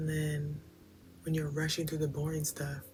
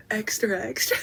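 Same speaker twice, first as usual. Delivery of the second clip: high, loud mock newsboy shout; hammed-up paperboy yelling the headline; newsboy cry; 6 semitones higher, 9 dB louder than usual. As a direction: call it out like a street paperboy. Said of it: "spreading the news, like, what is it called".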